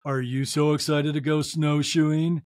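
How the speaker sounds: low, dead-level robotic question; monotone. Retold or imitated. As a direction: imitate the most monotone voice ever. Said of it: "in the most monotone vo-, the most monotone voice ever".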